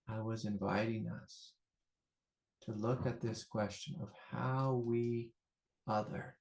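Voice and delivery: soft, measured voice with long pauses; meditative teaching. Acted. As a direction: guide listeners inward, quietly and unhurried.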